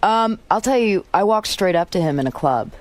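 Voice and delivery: normal talking voice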